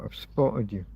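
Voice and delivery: usual speaking voice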